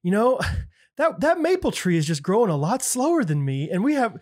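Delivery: high-pitched mock-aggrieved complaint; envious walnut tree.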